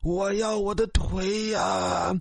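slow, drawn-out moaning ghost; long eerie ghost's lament; ghostly wail. Acted. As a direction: wail like a legless spirit chasing someone. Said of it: "嘴里不住地呼喊着".

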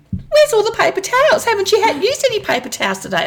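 high, puzzled cleaner asking; cleaner's voice. Retold or imitated. The cleaner asking the teacher about missing towels, high voice. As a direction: put on a high sing-song cleaner voice. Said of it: "she goes".

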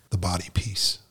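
whisper. Retold or imitated. Counselor explaining, dropped to whisper.

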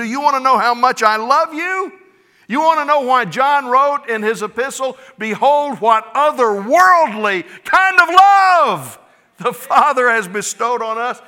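high, loud, soaring preacher climax; preaching at full force. Acted. Pastor tells congregation of God's adopting love, full-force preaching. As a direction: lift the whole room with it.

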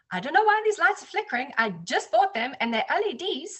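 mock fluster over the new lights; upset neighbor. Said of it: "And he's very upset".

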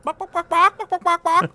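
high, loud rhythmic clucks; chicken clucking. Retold or imitated. Host imitates the chicken music, clucking. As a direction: cluck out a tune like a hen. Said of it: "chicken music".

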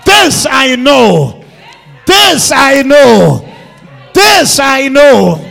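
slow, very loud preaching shout; leading the congregation in a shouted creed; shouted declaration. Shown as a demonstration. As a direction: drive each line home like a hammer. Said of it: "Can I have you shout it out?".

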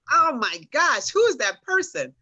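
high, loud retold disbelief; acted-out astonishment at a photo; shocked reaction. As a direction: exclaim it as if seeing a stranger.

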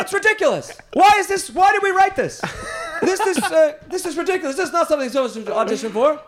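high, loud ranting impression; stammering mock outburst at full volume; Larry David. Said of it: "and Larry just goes".

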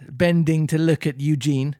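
usual speaking voice

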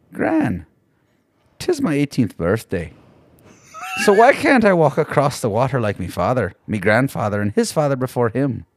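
lightly Irish grandson pleading with his gran; a hint of an Irish lilt; Irish accent. Grandson asking his gran why he can't walk on water, in a light Irish accent. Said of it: "your Irish accent is what makes that".

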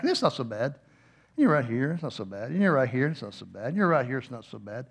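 low, hushed repeated muttering; mock self-reassurance. Nervous rider being hoisted back, low quiet self-reassurance. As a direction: soothe yourself softly, dropping at each phrase end.

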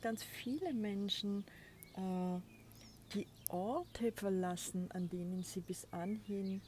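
normal talking voice

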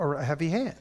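ordinary talk, nothing marked

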